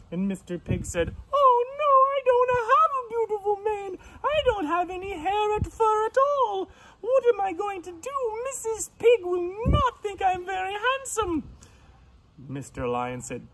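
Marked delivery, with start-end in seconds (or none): 1.3-11.4 very high, fast piggy wail; dismayed pig. Acted. Pig frets that he has no mane, high and panicky. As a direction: fret in a squealing rush. Voices worried Mister Pig.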